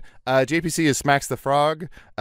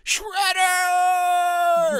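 very high, drawn-out shout; turtle scream. Acted. Player taking a turn at the turtle scream, high drawn-out shout. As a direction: stretch the name into a high battle yell. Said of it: "Okay, turtle scream?".

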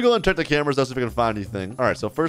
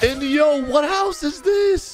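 very high, drawn-out amazement; incredulous high-pitched question; astonished; 14 semitones higher, slower than usual. Real, not acted. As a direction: blurt it out in disbelief, voice way up. Sees the house they chose.

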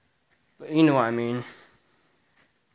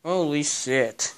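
usual speaking voice

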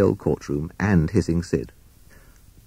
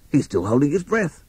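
Rat voice; 7 semitones higher than usual. Acted. Rat character voice.

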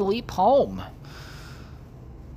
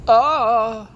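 yelp; 7 semitones higher, 9 dB louder than usual. Pretend. Startled put-on yelp.

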